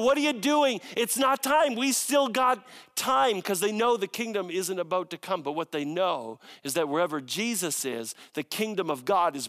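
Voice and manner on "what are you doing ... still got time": higher, louder panicked protest; frantic, pleading it's not time yet; panicked demons. Acted. Demons panicking at Jesus arriving early, high and loud. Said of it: "they're like freaking out".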